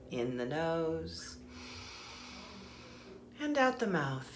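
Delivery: soft, unhurried meditation guide voice; guided meditation. Acted. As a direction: ease them inward with calm breath cues.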